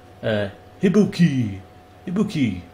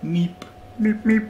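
cartoon meep; 10 semitones higher than usual. Silly noise.

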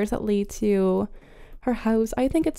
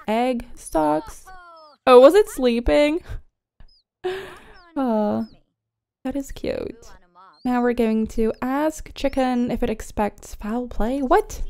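ordinary talk, nothing marked

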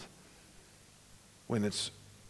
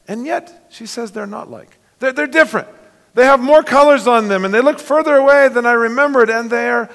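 put-on higher, breathless girl's voice full of wonder; wondering Lucy; 9 semitones higher, 7 dB louder than usual. Lucy gazing at the new hills, high and amazed. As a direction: marvel like a young girl seeing home. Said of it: "Lucy says".

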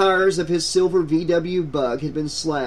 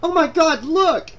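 high, loud cry of alarm; shocked; 12 semitones higher, 5 dB louder than usual. A narrator's reading. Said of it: "Julie stammered".

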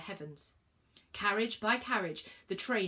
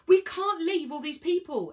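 loud, high protest; high-pitched, forceful objection; shouting back; 9 semitones higher, 9 dB louder than usual. A narrator's reading. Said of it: "Elliot shouted back".

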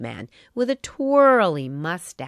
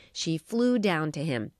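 ordinary delivery, nothing marked

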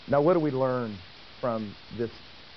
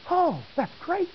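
put-on high, thrilled little girl's cry; imitating her sudden delight; delighted child. Father mimics daughter thrilled on training wheels, much higher.

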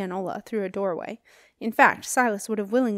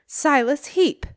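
slow, much higher and louder, rising on Heap; formal loud summons before the hall; loud announcement; 6 semitones higher, 6 dB louder, slower than usual. A narrator's reading. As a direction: proclaim his name like a verdict. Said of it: "she announced".

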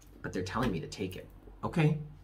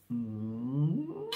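blown kiss; 4 dB quieter than usual. Real, not acted. Sign-off blowing viewers a kiss.

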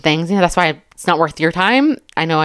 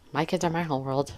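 softly repeated; 11 dB quieter than usual. Shown as a demonstration. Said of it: "What's wrong with that?".

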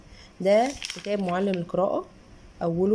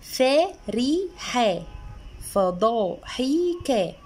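very slow, each syllable stretched; sounding out vowels; 2 semitones higher, much slower than usual. Shown as a demonstration. Mother reading the book's practice words for a child, very slow. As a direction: drag out every fatha and kasra like a first reader. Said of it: "فبيديني جمل بقى فيها فتحة وكسرة".

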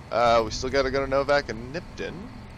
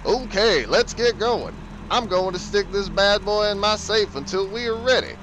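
high character voice; 8 semitones higher, 4 dB louder than usual. Pretend. Voicing the husband.

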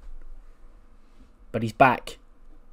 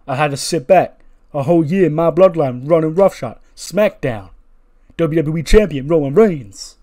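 loud, raised wrestler promo voice; Jey Uso; 3 semitones higher, 7 dB louder than usual. Acted. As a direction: punch out a forceful speech on the mic. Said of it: "take the microphone and he'd go".

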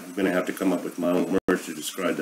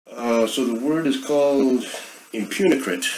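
grand announcement; 6 semitones higher than usual. Real, not acted. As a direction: present the new word proudly, drawing it out. Unveiling his invented word.

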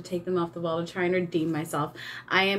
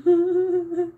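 a higher, louder laugh at being teased; 9 semitones higher, 5 dB louder than usual. Real, not acted. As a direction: laugh off the teasing. Eric teasing her.

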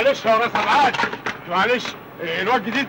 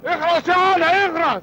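higher, sharp order to shut up; angry hush; 7 semitones higher than usual. Acted. Uncle orders Ali to shut up angrily.

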